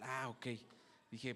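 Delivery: ordinary talk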